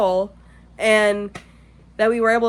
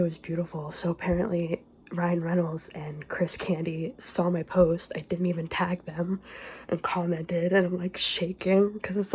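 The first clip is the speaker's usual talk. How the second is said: hushed voice, partly whispered; keeping quiet; 3 semitones lower, 6 dB quieter than usual. Real, not acted. Overwhelmed that idols saw the post, hushed. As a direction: confide it softly, freaking out but holding it down. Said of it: "I have to be quiet".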